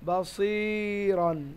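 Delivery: "বাসিরন" slowly with a long i; "বাসিরন" recited slowly with the vowel held; drawn-out recitation. Shown as a demonstration. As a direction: stretch the si of "বাসিরন", end on n. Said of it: "তাহলে এখানে কি পড়বো".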